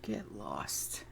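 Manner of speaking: normal talking voice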